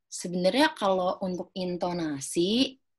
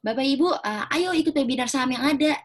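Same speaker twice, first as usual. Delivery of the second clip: high, loud, bouncy sales call; overexcited pitch; 8 semitones higher, 5 dB louder than usual. Shown as a demonstration. Pitching a stock webinar to office parents, overexcited. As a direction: hype it up too much for adults. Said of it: "nggak cocok ya".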